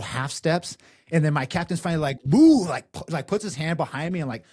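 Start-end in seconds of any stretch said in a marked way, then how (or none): 2.2-2.6 loud, high "boo" for a shove; sudden burst of sound, punched up high; imitated shove sound. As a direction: mimic the whoosh of being pushed. Imitating captain's shove into house.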